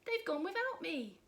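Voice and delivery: high, mildly woeful cry; lightly voiced forlorn youngster; cried out. A narrator's reading. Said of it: "And he cried".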